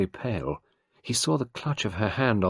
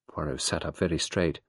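ordinary delivery, nothing marked

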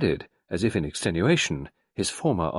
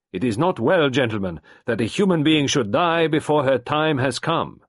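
louder, higher French doctor rising in protest; impassioned Frenchman; 5 semitones higher, 5 dB louder than usual. A narrator's reading. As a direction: fight for life with conviction. Gerard impassioned.

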